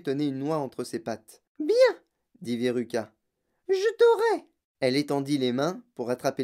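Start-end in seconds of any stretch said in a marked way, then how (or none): 1.6-2.0 put-on very high, loud child voice; spoilt girl. A narrator's reading. Girl choosing a squirrel, high determined voice. 3.7-4.4 put-on very high, loud child voice; determined spoilt girl. A narrator's reading. Girl grabbing at a squirrel, high determined voice.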